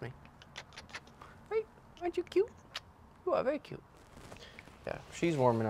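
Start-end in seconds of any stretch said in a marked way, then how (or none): 1.5-3.8 much higher cooing pet voice; high sing-song pet talk. Real, not acted. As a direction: coo at the bird sweetly.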